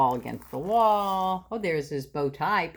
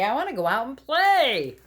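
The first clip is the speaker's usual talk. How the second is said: whiny protest; 6 semitones higher, 3 dB louder than usual. Acted. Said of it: "cried Froggy".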